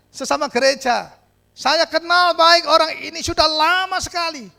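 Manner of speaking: loud, high sermon voice; fervent preaching; preaching at full force. Acted. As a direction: preach it out to the hall.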